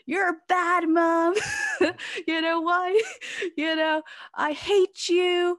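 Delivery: high, loud hammed-up kid yelling, laughing through it; mock angry child. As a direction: play the daughter's tantrum half-laughing. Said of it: "crying her eyes out and saying".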